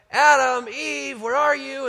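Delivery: high, loud call across the garden. Retold or imitated. God calling for Adam and Eve in the garden, loud high call.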